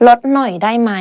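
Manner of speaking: ordinary delivery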